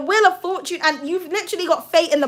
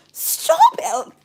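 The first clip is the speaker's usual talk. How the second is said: high excited squeal. Real, not acted. Reader squeals as a card flips over, high pitched.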